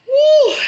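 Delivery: a loud high-pitched "woo" to shake it off. Real, not acted. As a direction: let out a big high whoop. Releasing emotion.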